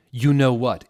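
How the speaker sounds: high, loud hammed-up teen rant; mock outraged fanfic writer; mock angry author. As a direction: read it like a furious teen author. Reading the author's angry note.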